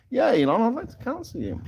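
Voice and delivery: mock taunt.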